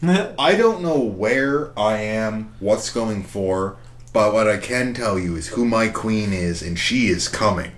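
usual speaking voice

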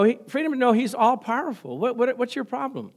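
ordinary talk

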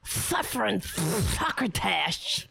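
spitty cartoon cat lisp; Sylvester cartoon voice. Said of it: "Does he really talk like that?".

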